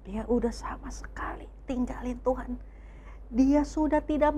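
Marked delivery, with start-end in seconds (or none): 0.1-2.5 hushed, grave whisper; whispered warning to the kids. A narrator's reading. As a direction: breathe it like a sad secret. Solemn aside to children.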